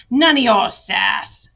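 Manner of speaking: slow, loud, cutting scold; cold scolding. Acted. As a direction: put the child in her place, slowly. Mother slaps and scolds daughter.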